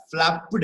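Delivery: flapped split into two beats, "flap-PED"; stress on second part. Shown as a demonstration. Teacher repeats the wrong flapped, ending split off and stressed. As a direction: sound out the ending of flapped separately. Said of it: "It's not flapped".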